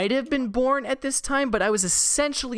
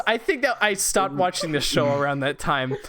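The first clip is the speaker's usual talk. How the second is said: ordinary delivery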